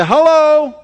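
call to the crowd. Real, not acted. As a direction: prompt the congregation to answer. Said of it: "Somebody say".

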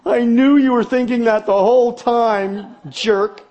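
lightly hammed-up accusing wife; mock wife retort. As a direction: snap back as the wife. Wife's retort.

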